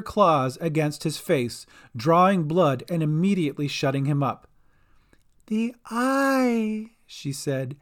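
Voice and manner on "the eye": high, drawn-out creature menace; Dolly. A narrator's reading. Dolly repeats demand after clawing Bink, menacing. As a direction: stretch the demand, pitched way up.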